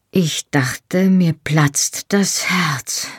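slow, breathless whisper. Acted. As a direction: barely get the words out, spent. Lover overwhelmed after climax.

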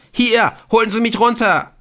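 very high, loud shriek. A narrator's reading. Ortolan shrieks from the window to the police. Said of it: "kreischte Ortolan".

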